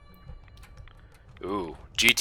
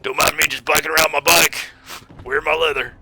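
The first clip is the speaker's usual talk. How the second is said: big gruff biker voice; loud gruff tough-guy biker; 6 semitones higher, 6 dB louder than usual. Pretend. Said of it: "talk in a big gruff biker voice like this".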